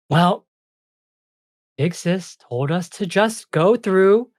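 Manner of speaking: high fairy voice, haltingly explaining; unsure little fairy; hesitant ice fairy. Acted.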